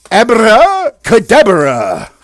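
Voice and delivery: loud, stretched-out magic incantation; booming drawn-out spell call; wizard casting spell. Acted. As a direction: cast it big, slow and high.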